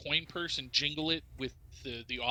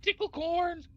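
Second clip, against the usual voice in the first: much higher kobold voice; Meepo. Acted. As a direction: disguise your voice as a small kobold. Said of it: "disguise my voice to be like that of Meepo".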